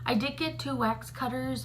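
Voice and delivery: ordinary talk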